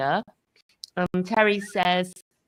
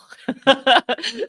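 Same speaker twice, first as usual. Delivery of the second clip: high, louder burst of laughter; amused laugh; 5 semitones higher, 7 dB louder than usual. Real, not acted. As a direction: laugh out loud after the announcement. Amused after announcing a new subscriber.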